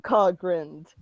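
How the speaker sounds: usual speaking voice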